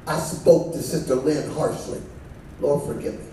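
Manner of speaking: soft penitent prayer. Pretend.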